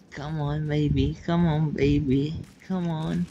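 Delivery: normal talking voice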